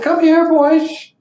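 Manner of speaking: high, slow, gentle call; soothing invitation. Retold or imitated. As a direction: Baba coaxing the crying boys closer. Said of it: "But Baba said".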